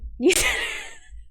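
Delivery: very high squeal of laughter; high-pitched squeal laugh; squeaky laugh. Real, not acted. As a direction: burst into a shrill giggle. Chat says how dare you.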